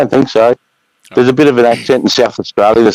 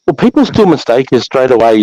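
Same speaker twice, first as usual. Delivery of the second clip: ordinary delivery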